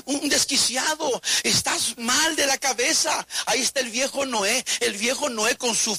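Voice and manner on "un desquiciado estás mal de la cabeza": mocking crowd. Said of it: "Y ahí andaban gritando".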